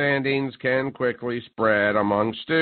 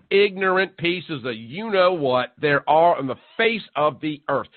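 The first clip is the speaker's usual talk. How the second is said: normal talking voice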